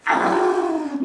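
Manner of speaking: high loud frustrated shout; child's angry cry. Retold or imitated. First grader shouting at her dad, high angry cry. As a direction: mimic a furious little kid. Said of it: "요 꼬마 아이가".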